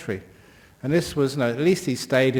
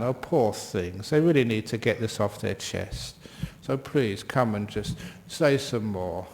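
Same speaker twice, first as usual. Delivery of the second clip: gentle compassion; 4 semitones lower, 5 dB quieter than usual. Pretend. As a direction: soothe them like a hurt child. Compassion for someone who offends.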